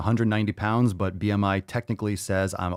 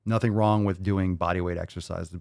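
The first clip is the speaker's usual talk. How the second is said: ordinary talk, nothing marked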